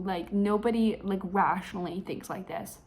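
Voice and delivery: normal talking voice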